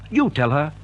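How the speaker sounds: ordinary talk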